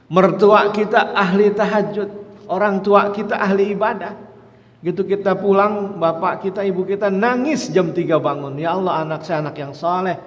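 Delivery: high, loud, impassioned sermon; rousing sermon voice; preaching at full force. Acted. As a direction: build it up to a climax. Preacher builds to climax.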